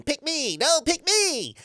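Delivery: high, tiny, eager pleading; high cartoon sandwiches begging; squeaky sandwich voice. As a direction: beg like a little sandwich. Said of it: "sandwiches in a thought bubble going".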